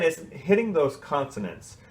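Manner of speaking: ordinary talk, nothing marked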